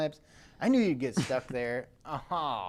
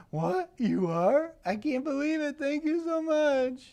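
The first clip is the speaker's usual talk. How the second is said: put-on high, thrilled kid voice; excited kid; 12 semitones higher, 4 dB louder than usual. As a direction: squeal with disbelief at the news. Reading the kid's excited text.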